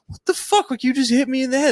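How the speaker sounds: high-pitched, incredulous protest; mock indignation. Passenger just smacked by airport agent, high indignant protest. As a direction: squeal it like a wronged passenger.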